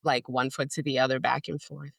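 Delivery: normal talking voice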